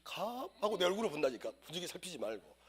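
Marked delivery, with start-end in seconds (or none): 0.0-0.5 hesitant questioning answer. Retold or imitated. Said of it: "이래야 되는데".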